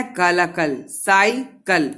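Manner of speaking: slow, stretched cycle, syllable by syllable; slow, drawn-out syllables. Shown as a demonstration. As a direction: draw out cycle slowly for the class. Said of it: "तो cycle जल्दी बोलते हो". (Cycle को धीरे, खींचकर बोलिए)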